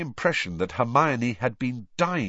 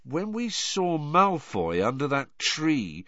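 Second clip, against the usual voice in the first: slow, higher, working it out; slowly; 6 semitones higher, slower than usual. A narrator's reading. Ron recalling Malfoy under the tree, slowly. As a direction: piece the memory together slowly. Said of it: "said Ron slowly".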